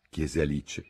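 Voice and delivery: ordinary delivery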